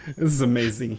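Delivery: usual speaking voice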